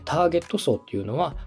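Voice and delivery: ordinary delivery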